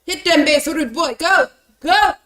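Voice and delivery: excited loud yelling; shouting. Real, not acted. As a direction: yell them on, hyped. Cheering player on in fight.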